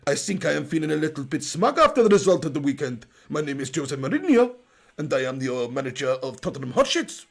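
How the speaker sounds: hammed-up Portuguese accent. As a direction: do Mourinho introducing himself. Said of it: "It is clearly a Portuguese accent".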